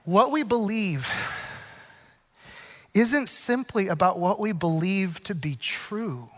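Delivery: slow, soft, earnest sermon; preaching. Acted. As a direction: preach it slowly and gently. Preaches the message.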